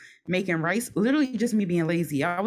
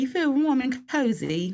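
higher-pitched, slipped-in British accent; 5 semitones higher than usual. Real, not acted. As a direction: slip accidentally into a British accent. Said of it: "about to speak in a British accent".

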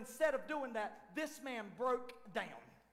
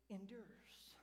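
breathy whisper of the key word; 3 semitones lower, 16 dB quieter than usual. Real, not acted. Preacher to the congregation on endurance, a hushed whisper. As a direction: let it fall to a bare whisper. Sermon drops to a hush.

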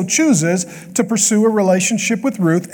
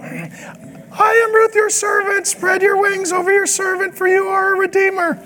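put-on much higher, very slow young woman; slow girl voice; 12 semitones higher than usual. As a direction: draw it out sweetly as a young girl. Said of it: "I don't do a good girl voice".

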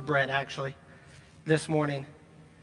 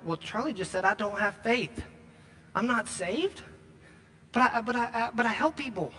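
whiny self-defense; 4 semitones higher than usual. Retold or imitated. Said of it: "you sitting there going".

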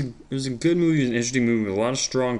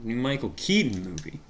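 ordinary delivery

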